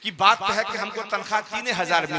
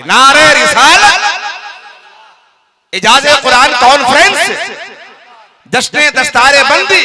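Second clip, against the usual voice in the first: slogan call; 4 semitones higher, 13 dB louder than usual. Real, not acted. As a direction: call the slogan out to the whole crowd. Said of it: "मैं नारा लगाता हूं".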